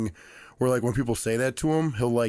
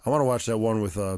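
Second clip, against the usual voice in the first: usual speaking voice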